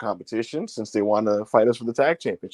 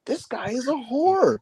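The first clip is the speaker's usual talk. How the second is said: mock accusing; 11 semitones higher than usual. Real, not acted. Teasing accusation of Rob.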